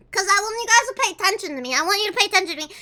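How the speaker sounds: rushed, quick plea; fast. Real, not acted. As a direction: blurt it out fast.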